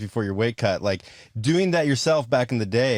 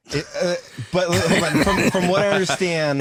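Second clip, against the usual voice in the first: laughing while asking; 5 semitones higher than usual. Real, not acted. Host laughs mid-question.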